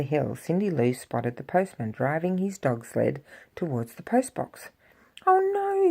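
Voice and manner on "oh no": alarmed cry. Acted. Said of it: "Cindy Lou cried".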